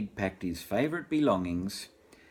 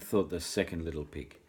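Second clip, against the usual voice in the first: ordinary delivery, nothing marked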